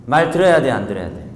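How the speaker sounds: ordinary talk, nothing marked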